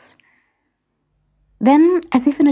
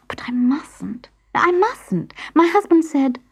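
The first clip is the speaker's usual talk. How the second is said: anxious hesitation; 7 semitones higher than usual. A narrator's reading. Pandora resisting temptation.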